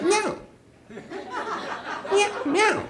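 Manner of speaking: high, rising mock meow; high playful meow sound; imitated cat meow. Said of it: "That, I think that was the sound".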